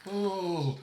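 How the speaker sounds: high pained oh; pained cry. Acted. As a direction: cry out in pain. Pain cry.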